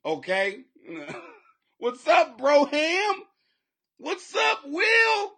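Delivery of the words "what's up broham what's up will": high, loud hollered hello; shouted greeting. Real, not acted. Host greets viewers joining the show, shouted greeting. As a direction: holler out to friends arriving.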